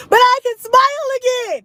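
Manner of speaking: high, beaming; delighted. Real, not acted. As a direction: say it with joy. Happy about fixed tooth.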